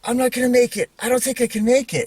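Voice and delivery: high-pitched imitation of a scared little brother; mock panicked wail; freaking out. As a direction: act out a kid panicking, pitch way up. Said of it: "he's starting to freak out".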